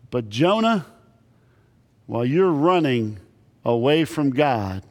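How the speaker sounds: ordinary delivery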